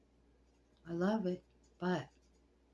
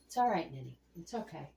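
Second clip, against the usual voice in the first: ordinary delivery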